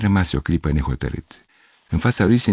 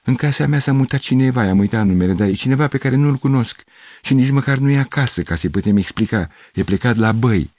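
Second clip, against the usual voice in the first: anxious rambling; 7 semitones higher than usual. A narrator's reading. Anxious explanation.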